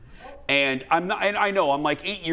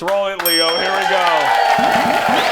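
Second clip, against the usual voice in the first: loud, drawn-out show opener; announcer kickoff; 3 semitones higher, 9 dB louder, much slower than usual. Acted. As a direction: call out the segment start like a host. Host kicks off the segment.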